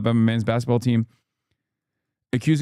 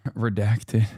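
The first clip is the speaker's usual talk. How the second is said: laughing; 3 semitones lower, slower than usual. Shown as a demonstration. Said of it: "sorry, retract it".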